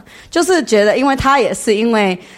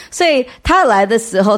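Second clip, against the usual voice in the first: normal talking voice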